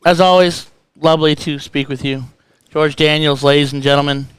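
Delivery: loud ring-announcer roll call of nicknames; announcer send-off. Acted. Host saying goodbye to George for the audience, loud announcer voice. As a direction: hype him up loudly, title by title.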